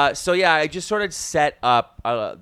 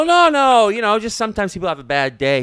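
hurried reassurance; 9 semitones higher, 8 dB louder than usual. Retold or imitated. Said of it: "I go".

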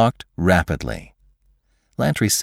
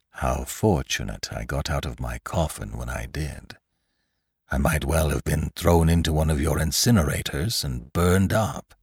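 guarded Lantry; 2 semitones lower, 3 dB quieter than usual. Acted. Lantry reflects on escaping the incinerators, guarded and soft. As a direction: muse quietly, giving little away.

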